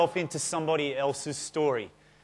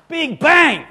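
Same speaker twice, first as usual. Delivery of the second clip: big bang shouted much higher and louder; 10 semitones higher, 10 dB louder than usual. Acted. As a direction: make big bang explode. Preacher's dramatic punchline.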